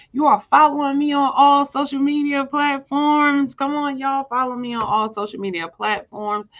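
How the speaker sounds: high, loud and bright-eyed plug, pushing the crowd; rally the listeners like a hostess; excited hype. Real, not acted. Speaker urging her audience to follow her, excited high and loud.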